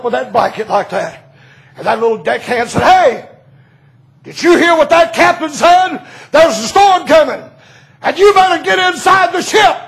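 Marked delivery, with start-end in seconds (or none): 2.8-9.8 high, loud call to get inside; deckhand's shouted warning. Retold or imitated. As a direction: yell the storm warning at her.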